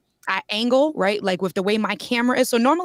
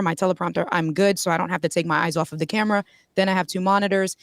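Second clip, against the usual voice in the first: ordinary talk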